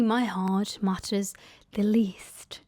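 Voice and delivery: slow whispered confession; whispered aside. Real, not acted. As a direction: drop to a self-pitying whisper. Bitter aside about herself.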